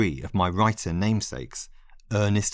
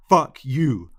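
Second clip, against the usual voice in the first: louder, sharp angry snap; snapped; 5 semitones higher, 7 dB louder than usual. Acted. Lionel snapping at the narrator, sharp angry retort. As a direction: bite the words off. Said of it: "Lionel snapped".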